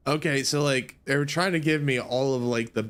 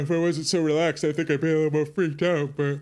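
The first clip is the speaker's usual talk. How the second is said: ordinary talk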